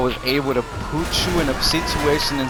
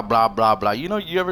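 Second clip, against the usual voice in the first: normal talking voice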